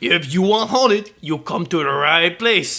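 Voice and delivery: put-on slow Cajun boat captain accent; drawn-out Cajun accent for a spooky boat captain; Mark Hamill Cajun accent. Imitating Mark Hamill's boat captain, slow Cajun accent. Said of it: "my entire accent is built around that".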